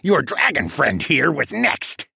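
high, slow squawky threat; penguin villain threat. A narrator's reading.

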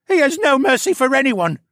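very high, loud whine; sniveling captive. A narrator's reading. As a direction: wail it as the cowardly prisoner. Sniveling captive corrects his partner.

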